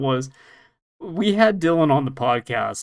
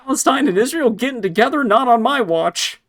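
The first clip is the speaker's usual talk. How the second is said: high, loud hammy villain outrage; 10 semitones higher, 5 dB louder than usual. Pretend. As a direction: play a cartoon bad guy, indignant. Voicing the villain Vega, mock outrage.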